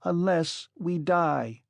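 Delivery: a lightly voiced machine man, deliberate; mechanical voice. A narrator's reading. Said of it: "clicking slightly".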